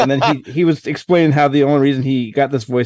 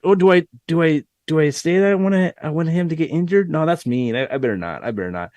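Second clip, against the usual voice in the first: hesitant backing down; 3 dB quieter, faster than usual. Retold or imitated. Host mocks caller's early timid self, dwindling to soft. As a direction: imitate a meek caller losing nerve. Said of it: "at that time, Rick was like".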